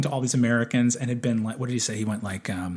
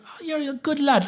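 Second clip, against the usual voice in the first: lightly voiced kindly Irishman; 12 semitones higher than usual. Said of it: "And he's like".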